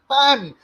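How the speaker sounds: high loud bang, the last shot; gunshot bang. Pretend. Imitating gunfire after rap.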